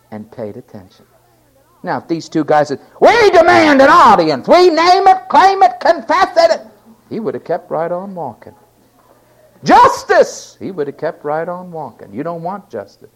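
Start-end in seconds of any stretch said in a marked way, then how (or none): 3.0-6.6 high, loud, pushy demand; hammed-up loud name-it-claim-it demand; mock demand. 9.6-10.6 high shouted demand; hammed-up shout for justice; mock shout.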